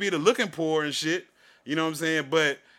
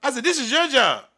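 higher and louder, indignant scolding; heated retort; 9 semitones higher, 6 dB louder than usual. Retold or imitated. Retelling how he told off the cashier, a higher, louder indignant scolding. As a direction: put her in her place, voice raised.

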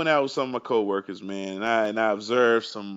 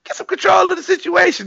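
high, loud, strained cry; exasperated high yelp at a clueless boyfriend; exasperated plea; 7 dB louder than usual. Retold or imitated. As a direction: beg him to rein her in, voice cracking high. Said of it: "I'm sitting there like".

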